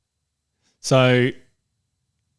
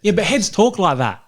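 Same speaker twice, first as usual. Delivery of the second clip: higher put-on Australian accent; mock Australian accent; 8 semitones higher than usual. Co-host answering that locals talk so, higher mock Australian accent. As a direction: imitate an exaggerated Australian way of talking. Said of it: "do a voice like this".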